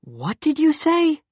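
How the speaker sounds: surprised. Acted. Youth stunned by the claim.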